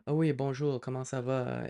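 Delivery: light imitation of a polite France French accent; mock-refined French from France; Parisian French accent. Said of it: "French from France is more like".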